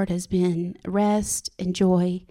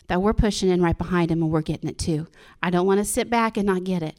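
normal talking voice

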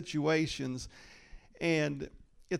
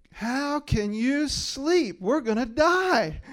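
slow, much higher, louder mock accusation; hammed-up panicked disciples blaming Jesus; accusing disciples; 9 semitones higher, 7 dB louder than usual. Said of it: "they woke him up accusing him".

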